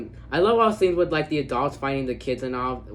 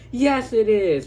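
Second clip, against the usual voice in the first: excited shout; 9 semitones higher, 6 dB louder, slower than usual. Retold or imitated. Susie answers the triumphant yell.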